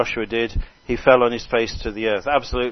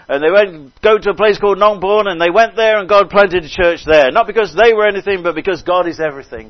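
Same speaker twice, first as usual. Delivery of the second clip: raised, loud preacher's testimony; impassioned preaching; 6 semitones higher, 7 dB louder than usual. Real, not acted. Preacher telling how God planted churches, impassioned preaching. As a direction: drive each example home with force.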